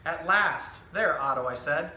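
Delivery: lively picture-book storytelling voice, each line clearly paced; reading to children. Acted. Zookeeper reading a book to children, animated storytelling. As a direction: tell the story to a room of young kids.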